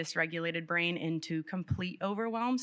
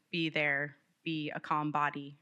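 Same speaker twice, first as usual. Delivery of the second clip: usual speaking voice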